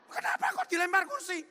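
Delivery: high, loud, astonished question; surprised. Retold or imitated. Boss shocked that the tailor nearly threw a chair, high and loud. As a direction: recoil in disbelief at the news.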